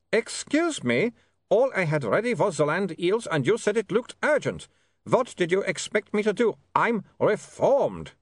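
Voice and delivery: put-on high-pitched, accented vampire in protest; indignant vampire Otto. A narrator's reading. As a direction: defend yourself as an affronted vampire.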